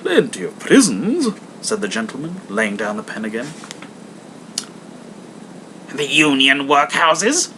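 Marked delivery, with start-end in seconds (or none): 0.0-1.3 a courteous charity collector; hammed-up polite visitor; portly gentleman. 5.9-7.5 slow, pressing demand; mock demanding old miser. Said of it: "demanded Scrooge".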